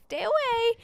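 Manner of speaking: high-pitched playful "stay away"; mock warning. Jokingly warding off friends' colds, high mock warning. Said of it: "I'm like".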